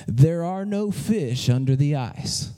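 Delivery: deep, slow, loud voice from above; mysterious voice. Acted. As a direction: boom it out low like a voice from heaven. Booming voice from nowhere in joke.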